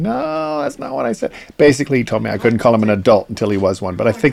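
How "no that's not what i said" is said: high, whiny mock teen; imitating teenage son. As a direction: mimic a protesting teenager, high. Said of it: "my engineer going".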